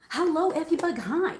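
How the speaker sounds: high, loud sing-out hello; excited, high-pitched hello; bright greeting call. Real, not acted. Greets someone arriving mid-stream.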